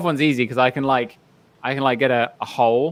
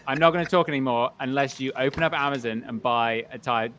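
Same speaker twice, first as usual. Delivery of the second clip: ordinary delivery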